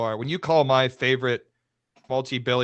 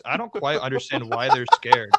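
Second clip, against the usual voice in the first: ordinary delivery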